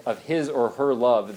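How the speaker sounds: normal talking voice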